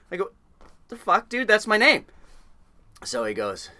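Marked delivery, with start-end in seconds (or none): 0.9-2.1 high, loud, incredulous outburst; exasperated protest. Retold or imitated. New deckhand insisting on his name, loudly exasperated. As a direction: protest loudly, voice shooting right up. Exasperated comeback in the retold quarrel.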